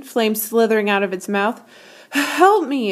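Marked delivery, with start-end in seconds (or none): 2.1-2.9 higher, pleading dragon. A narrator's reading. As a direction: beg for help. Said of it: "it pleaded".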